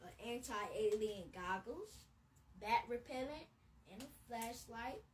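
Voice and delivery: put-on storybook voice for kids; bedtime-story narration; reading to children. A narrator's reading. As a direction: read it like a picture book to little ones. Reads picture book aloud.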